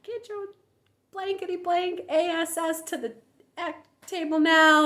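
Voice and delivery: high, harsh mock yell of an angry parent; abusive parent yelling. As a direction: bark the kid to the table. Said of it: "Us abused people got".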